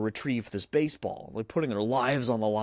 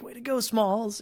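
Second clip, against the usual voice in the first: put-on high cheering kid voice; mock cheer; 12 semitones higher than usual. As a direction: cheer him on like a teammate. Boys cheering the home run.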